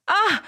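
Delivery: sharp high cry of pain; pained scream. Acted. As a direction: yelp as the slap lands. Sister slapped across the face. (뺨을 맞고 지르는 날카로운 비명)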